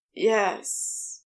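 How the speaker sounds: moaned. Acted. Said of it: "Crowley moaned".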